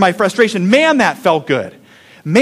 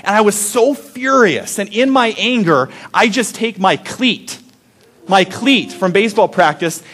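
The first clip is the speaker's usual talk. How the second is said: normal talking voice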